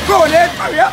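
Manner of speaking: high loud call to Ed; shouting encouragement. Real, not acted. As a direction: cheer him on from afar.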